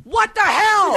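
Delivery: high, startled outburst. Real, not acted. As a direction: react to a sudden noise. Startled by someone at door.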